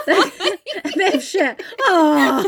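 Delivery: slow, high words through laughter; laughing. Real, not acted. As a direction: wheeze it out laughing. Laughing at own joke.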